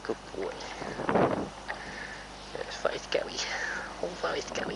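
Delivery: hushed soothing whisper; soft whisper. Real, not acted. Rider comforting her horse, whispering softly. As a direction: whisper it gently to calm him.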